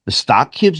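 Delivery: "stock cubes" with an American "stock"; Scottish speaker faking American; Americanised accent. Retold or imitated. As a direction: switch to a flat American vowel in "stock". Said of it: "that fucking vowel sound".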